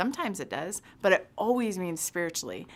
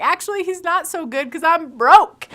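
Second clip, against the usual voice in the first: much higher and louder, bitter; upset retort; 11 semitones higher, 13 dB louder than usual. Retold or imitated. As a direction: snap back, stung and broke. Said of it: "I was really upset".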